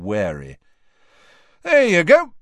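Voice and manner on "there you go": loud, high, proud presentation. A narrator's reading. Fred shows the girls his products, proud. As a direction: show it off loudly and proudly. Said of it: "said Fred proudly".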